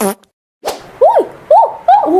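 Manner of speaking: very high, loud shouting; shrill yelling. Acted. Aunty yells loudly at the girl in her room. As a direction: yell it out at full pitch. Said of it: "लड़कियां ऐसे नहीं चिल्लाती".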